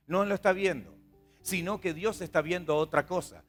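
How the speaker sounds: usual speaking voice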